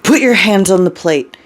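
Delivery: sharp, pressing order; barked order. A narrator's reading. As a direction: bark it out under pressure. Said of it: "he barks".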